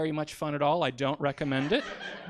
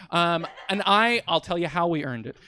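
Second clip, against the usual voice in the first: ordinary delivery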